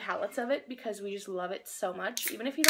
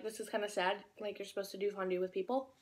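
usual speaking voice

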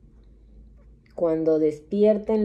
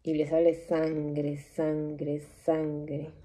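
each sangre softer than the last; fading hush; 3 semitones lower, 6 dB quieter, slower than usual. Acted. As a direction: let the words die away into a hush. Describing blood in her vision.

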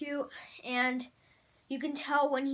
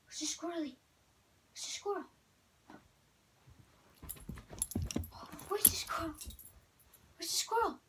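talking to a dog; 6 semitones higher, 4 dB quieter than usual. Real, not acted. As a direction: tease the dog with excited questions. Exciting dog with words.

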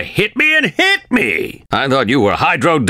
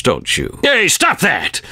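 ordinary talk

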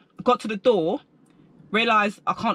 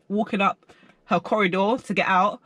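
usual speaking voice

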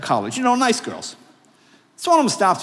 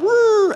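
crash whoop; 5 dB louder than usual. Acted. Crash sound effect.

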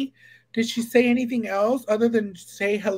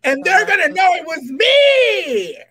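shouted through big laughter, higher; laughing loudly; 9 semitones higher, 7 dB louder than usual. Real, not acted. Friend jokes about haunting her room, laughing loudly. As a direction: crack up and shout it out.

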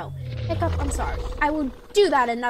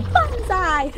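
high, louder cartoon battle cry; Ruby yell; 6 semitones higher, 6 dB louder than usual. Vlogger imitating Ruby yelling during the mission. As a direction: shout it like an eager anime heroine. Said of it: "Ruby yelled".

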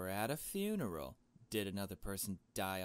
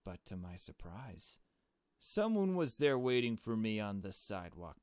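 normal talking voice

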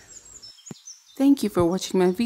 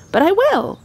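put-on high, louder boyish vow; eager promise; 12 semitones higher, 5 dB louder than usual. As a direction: promise it proudly like a boy. Mowgli determined boast.

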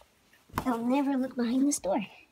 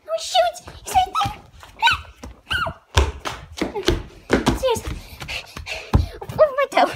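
high frantic shrieks; panicked shouting; 10 dB louder than usual. Real, not acted. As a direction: yelp in a panic. Panicked shouting while chased.

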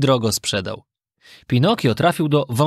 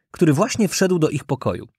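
usual speaking voice

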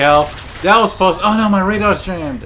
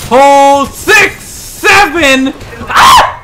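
excited shout; 7 semitones higher, 4 dB louder, much slower than usual. Real, not acted. Killstreak cheering.

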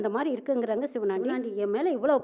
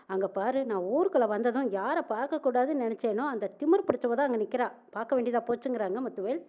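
plain reading voice

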